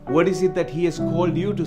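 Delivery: ordinary talk, nothing marked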